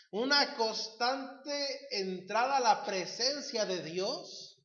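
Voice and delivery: normal talking voice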